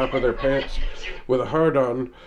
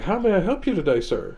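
innocent nonchalance; 6 semitones higher than usual. Retold or imitated. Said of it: "nonchalantly like".

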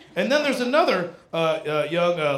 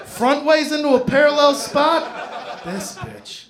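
outraged dog; 7 semitones higher, 6 dB louder than usual. Retold or imitated. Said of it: "even the dog is like".

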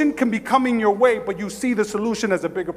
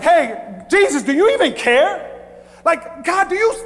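raised voice calling out in reproach; indignant appeal; 10 semitones higher, 4 dB louder, slower than usual. Acted. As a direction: act out a desperate, higher-pitched challenge. Acting out indignant disciples calling Jesus.

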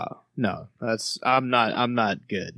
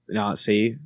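normal talking voice

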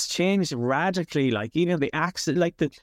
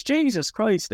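higher surprised farmer exclamation; shouty surprise; 8 semitones higher, slower than usual. Shown as a demonstration. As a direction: blurt it out in shock. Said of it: "the shouty one ... the surprise".

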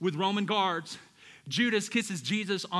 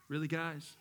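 weary sigh; 4 semitones lower, 6 dB quieter than usual. Retold or imitated. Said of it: "He sighed deeply".

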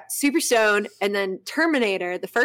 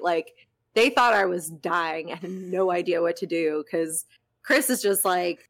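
normal talking voice

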